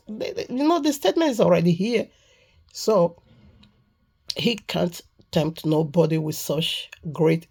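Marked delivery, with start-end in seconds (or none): none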